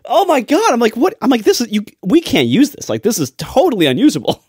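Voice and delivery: high-pitched, appalled complaint; exasperated disbelief. Retold or imitated. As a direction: protest it like a horrified editor. Retelling exasperated reaction to ticking.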